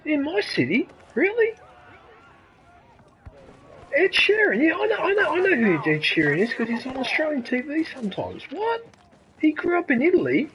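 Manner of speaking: high-pitched, incredulous questioning; astonished. Real, not acted. Streamer reacting to news about [name], astonished. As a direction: react in real surprise, voice jumping up high. Learns a star grew up in Italy.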